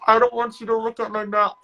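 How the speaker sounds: high, put-on voice of protest; imitated flustered guard. Security guard to a student dentist, imitated fluster.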